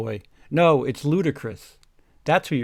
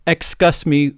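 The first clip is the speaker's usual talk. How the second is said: louder repeat of excuse me; yelled; 6 dB louder than usual. Acted. Janitor calls out into the dark corridor, louder. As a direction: call it out sharply. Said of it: "Yelled Mister Norris".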